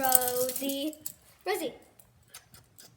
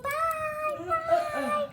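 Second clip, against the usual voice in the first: dog saying bye. Pretend. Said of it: "Lou would like to say goodbye".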